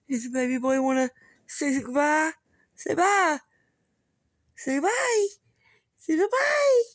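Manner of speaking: squeaky pet-talk that keeps rising. Real, not acted. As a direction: coax the dog to say bye, climbing up each time. Coaxing the dog to wave bye.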